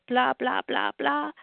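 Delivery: ordinary delivery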